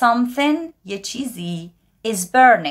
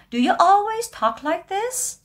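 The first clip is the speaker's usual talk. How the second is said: much higher, slow, put-on voice; incredulous asker; 12 semitones higher than usual. Teacher voicing someone amazed at how another talks, high and slow. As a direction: act a friend who can't believe it.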